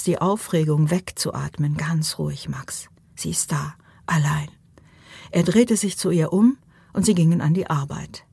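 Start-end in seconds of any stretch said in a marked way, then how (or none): 1.8-4.5 soft whisper to himself; hushed self-talk to calm down. Acted. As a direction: whisper it to steady yourself. Calming himself, breathing out excitement.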